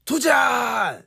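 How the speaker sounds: high, stretched-out show call; catchphrase shout. Retold or imitated. Host hinting at a TV show, imitated catchphrase shout.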